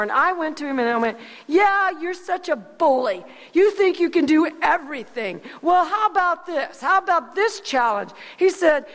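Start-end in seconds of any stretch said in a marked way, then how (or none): 1.5-8.1 defiant taunt. Retold or imitated. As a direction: stand up to him and dare him. Boy taunting the school bully.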